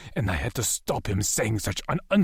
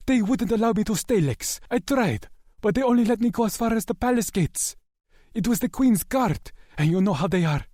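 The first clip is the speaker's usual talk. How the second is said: higher, pleading servant's voice; distressed young man; 12 semitones higher, 3 dB louder than usual. A narrator's reading. Asher explaining to Lex he was turned away, high anxious voice. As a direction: plead your helplessness, voice lifted high.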